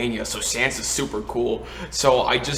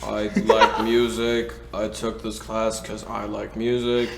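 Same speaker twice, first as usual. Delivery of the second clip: stone-cold deadpan; 4 dB louder than usual. Retold or imitated. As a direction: imitate someone icily expressionless. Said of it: "the most stone cold dead expression".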